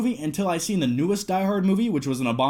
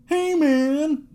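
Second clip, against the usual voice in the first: much higher, louder laid-back dude greeting; Bill Paxton impression; 11 semitones higher, 3 dB louder than usual. Shown as a demonstration. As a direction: toss off a high, drawn-out Bill Paxton hey man. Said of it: "That's my Bill Paxton impression".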